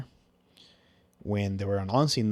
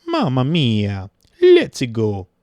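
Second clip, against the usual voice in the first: slow, loud Mamma mia, stretched out; loud, drawn-out Mario imitation; Mario catchphrase; 3 semitones higher, 11 dB louder, much slower than usual. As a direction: belt the catchphrase like the game hero. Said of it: "He just says".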